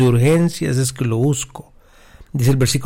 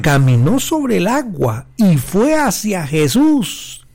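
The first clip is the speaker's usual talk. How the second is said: rising climax; 6 semitones higher than usual. Acted. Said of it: "clímax de la prédica".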